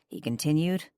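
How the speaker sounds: ordinary delivery, nothing marked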